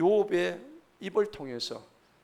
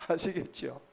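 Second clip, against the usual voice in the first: laughing; 4 dB quieter than usual. Real, not acted. Laughs after repeating his slang.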